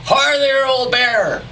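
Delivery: loud high holler at a bear. Acted. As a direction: holler it across the range. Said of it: "I hollered".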